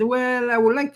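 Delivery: lightly voiced young boy making a wish; eager young self. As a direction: lift the voice up high like a hopeful ten-year-old. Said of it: "So I said".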